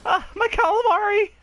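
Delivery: high, hammed-up defeat cry; mock villain wail.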